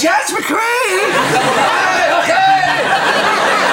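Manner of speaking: high, loud, delighted call of the name; hat check girl greeting him, delighted and loud; excited greeting. Retold or imitated. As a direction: shout it gleefully across the room. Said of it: "And then she goes".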